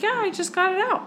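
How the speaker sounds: put-on high, quick little-kid reply; retold child's voice. Daughter on phone to mom about splinter, imitated child voice. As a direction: imitate a small child reporting success. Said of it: "She's like".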